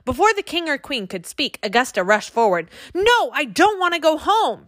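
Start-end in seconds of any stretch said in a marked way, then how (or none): 3.0-4.7 put-on a small girl protesting; high little scream. A narrator's reading. Augusta refusing to go home, mock high scream. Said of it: "she screamed in a high little voice".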